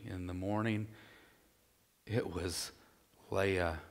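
a quiet, weighty repeat; hushed emphasis. Shown as a demonstration. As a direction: underline it by dropping the voice. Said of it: "You can kinda underline that".

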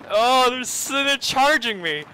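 loud, high, drawn-out cry of alarm; panicked yelp. Real, not acted. Gamer seeing enemies charging him, panicked yelp.

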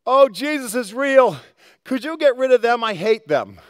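high, loud, eager voice of a brand-new convert; hammed-up joyful convert; mock gushing new believer. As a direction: play the naive believer thrilled and asking for revenge. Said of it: "you don't go".

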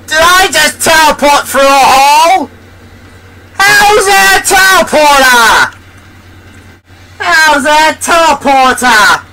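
high-pitched, baffled protest; incredulous outcry. Real, not acted. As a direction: demand it high, in sheer disbelief. Baffled by unexpected teleport in game.

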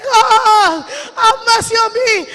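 loud, high cry for mercy; anguished cry. Acted. Friend burning in fire begs Jesus, anguished cry. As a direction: wail out a desperate plea from torment. Acted plea of the damned in fire.

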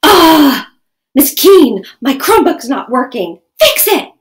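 loud, high, demanding whine; bratty yelling demand at the teacher; fighting tone of voice. Shown as a demonstration. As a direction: snap it out like a cranky kid. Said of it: "it sounded something like this".

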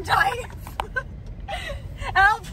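words breaking up in laughter; can't stop laughing, high and helpless; gasping "help" through laughter; laughing helplessly. Real, not acted. Laughing fit stuck in garage.

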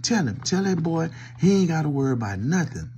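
ordinary talk

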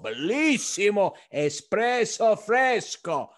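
loud, high, drawn-out Italian flourish; Italian accent. Retold or imitated. Owner shows off espresso to every Italian guest, Italian accent. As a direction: proclaim it slowly in an exuberant Italian accent. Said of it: "says the same thing".

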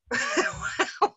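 a sudden high burst of real laughter. Real, not acted. Author laughing at her own joke about hearing voices, high real laugh.

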